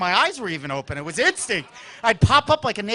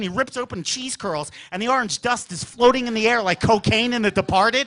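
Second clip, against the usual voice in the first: ordinary talk, nothing marked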